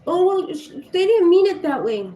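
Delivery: mock the parent brushing it off; sarcastic parody of an excuse; mocking parent's excuse. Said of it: "And they said".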